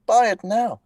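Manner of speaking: high, loud shouted toy ad; shouting ad read. Retold or imitated. Voice actor recalling his Lego ads, high loud shout. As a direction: yell it into the mic to sell Lego. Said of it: "as me shouting into a microphone".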